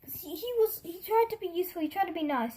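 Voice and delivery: ordinary delivery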